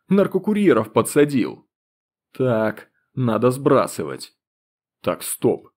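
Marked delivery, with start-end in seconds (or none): none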